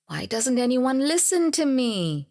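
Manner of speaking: acted-out plaintive frustration; hurt, exasperated plea. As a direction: pitch climbing in aggrieved protest. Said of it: "your ego is bruised".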